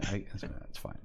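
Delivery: normal talking voice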